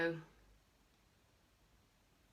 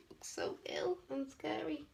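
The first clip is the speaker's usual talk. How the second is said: spooky whisper; 10 semitones higher, slower than usual. Acted. Mum voicing a spooky look.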